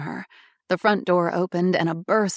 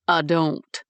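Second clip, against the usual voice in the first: gritted teeth. Acted. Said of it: "through gritted teeth".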